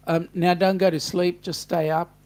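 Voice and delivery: normal talking voice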